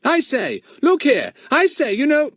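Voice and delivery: high, loud flustered protest. A narrator's reading. Fatty protests to the kidnapper, high and loud. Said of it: "Fatty followed, protesting".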